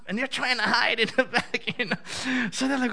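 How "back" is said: normal talking voice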